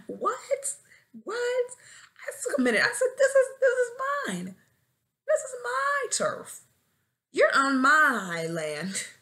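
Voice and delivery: high, loud mock outrage; hammed-up indignation. Teacher claiming their classroom as turf, hammed-up indignation. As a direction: stake your claim in a drawn-out, high cry.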